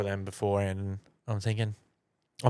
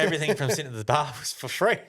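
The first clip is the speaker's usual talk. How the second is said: laughing; 6 semitones higher than usual. Real, not acted. Telling the free bill payoff.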